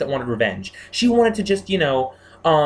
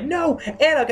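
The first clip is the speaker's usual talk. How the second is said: pleading protest; 11 semitones higher than usual. Retold or imitated. Protesting Anna's staking.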